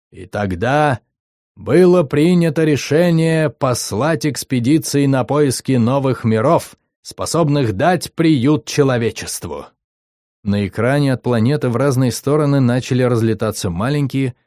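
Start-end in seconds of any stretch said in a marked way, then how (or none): 0.1-9.7 медленно, торжественно, как диктор кинохроники; голос старой кинохроники, торжественно; торжественно и волнующе. Acted. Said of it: "стал торжественным и волнующим". (диктор старой кинохроники, торжественно)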